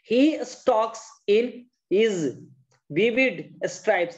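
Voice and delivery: very slow, each word drawn out; slow model reading. Shown as a demonstration. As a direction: read the line slowly for the class to copy. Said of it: "जैसे मैंने बोला".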